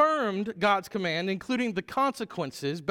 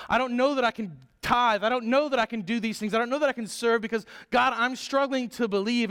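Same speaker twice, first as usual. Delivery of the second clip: higher, louder, rushing anxious plea to God; a doubter praying to God, pressed and pleading; struggling believer praying; 5 semitones higher, 3 dB louder than usual. Acted. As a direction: pour out doubts to God in a hurried rush. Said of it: "Is God going to show up".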